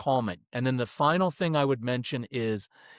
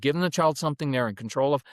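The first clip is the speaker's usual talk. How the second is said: ordinary delivery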